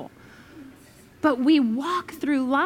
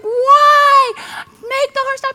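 much higher, loud and desperate; panicked plea; 12 semitones higher, 10 dB louder, slower than usual. Retold or imitated. Rider begging the pastor to stop the horse, high and frantic. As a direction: beg frantically.

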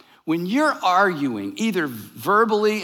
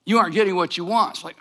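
ordinary talk, nothing marked

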